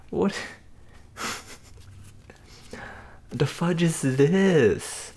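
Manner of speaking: high, slow, stretched-out disbelief; shocked disbelief. Real, not acted. Vlogger opening a wrong sandwich on camera, slow high disbelief.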